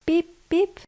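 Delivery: put-on high, chirpy baby-duck peeps; ducklings peeping. A narrator's reading. Newborn ducklings greet the world, high chirpy peeping. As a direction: peep it out like a tiny hatchling. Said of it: "they said".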